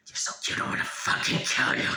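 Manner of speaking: forceful, strained whisper with no voice; intense threatening whisper. Retold or imitated. Child off to the side with a puppy, fierce strained whisper. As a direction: squeeze the words out, building in force. Re-enacting threats to a cute animal.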